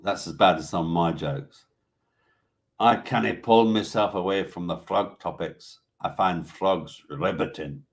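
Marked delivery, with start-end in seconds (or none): 2.8-7.8 hammed-up Scottish brogue; bad Scottish accent. Host reads out Tony's frog joke, bad Scottish accent. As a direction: put on a badly faked Scottish accent. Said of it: "That's a bad Scottish accent".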